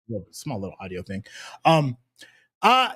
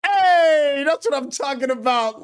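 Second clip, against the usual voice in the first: hype; 8 semitones higher, slower than usual. Real, not acted. Hyped greeting to the audience.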